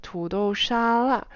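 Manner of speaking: slow 土豆沙拉 with one smooth rise and fall; 土豆沙拉 stretched out as one contour; whole-word contour. Shown as a demonstration. Teacher shows 土豆沙拉 slowly, as one pitch shape. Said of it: "when you say the whole word potato salad".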